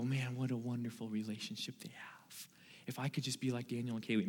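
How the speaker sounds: hushed, breathy envious murmur; whispered admiring aside. Pretend. Congregation member wistfully admiring the pastor's marriage, whispered.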